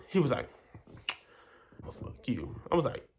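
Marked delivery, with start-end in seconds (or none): 1.8-2.6 low murmur. Retold or imitated. Boy murmurs a come-on to the narrator, low and soft. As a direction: lower and much softer, half under the breath.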